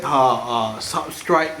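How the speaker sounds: usual speaking voice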